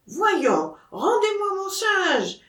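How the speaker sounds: put-on very high Auvergnat accent, pleading; Auvergnat demand. Seller reaches out for his monkey, high Auvergnat accent. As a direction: cry it out high, Auvergnat "chinge".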